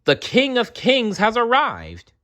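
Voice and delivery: high joyful proclaiming call; crowd shout. Acted. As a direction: announce it to the street. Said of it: "The crowd shouted".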